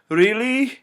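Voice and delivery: high, incredulous question; outraged disbelief. Acted. Hypnotist reacting to Will's joke, outraged disbelief.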